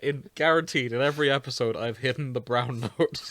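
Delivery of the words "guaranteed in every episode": ordinary talk, nothing marked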